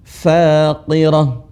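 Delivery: Faqirah with flowing breath on the final ah; breathy qaf-ra ending. Shown as a demonstration. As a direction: stretch Faqirah and let the breath hiss out. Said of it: "Ada suara napas yang mengalir atau desis". (Faqirah dengan napas mengalir di akhir)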